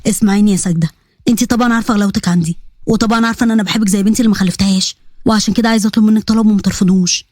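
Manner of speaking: low voice, gently pleading. Acted. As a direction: ask a favour like a loving uncle.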